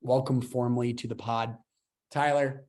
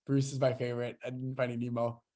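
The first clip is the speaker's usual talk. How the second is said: usual speaking voice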